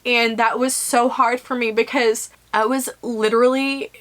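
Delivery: ordinary delivery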